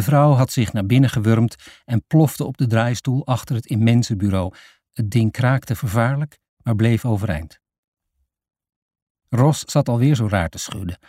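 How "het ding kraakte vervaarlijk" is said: ordinary delivery, nothing marked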